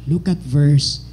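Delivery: ordinary talk, nothing marked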